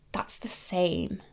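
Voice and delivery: a slow, awed whisper, almost to herself; stunned whisper. Real, not acted. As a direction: breathe it out, slow and hushed. Stunned at matching lipstick shades.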